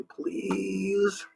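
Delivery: high, soft, coaxing please; sweet please. Shown as a demonstration. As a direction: ask nicely like a child.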